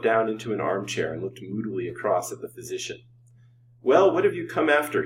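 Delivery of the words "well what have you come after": high, loud testy demand; abrupt, testy. A narrator's reading. Host demands why the doctor came, testily. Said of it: "he asked abruptly and testily".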